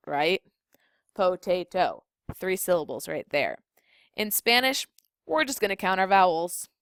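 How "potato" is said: "Potato" broken into po, tay, to; syllable by syllable. Shown as a demonstration. Spanish teacher showing how to count syllables, "Potato" said syllable by syllable. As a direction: sound out "Potato" in three separate beats. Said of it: "we just clap it out".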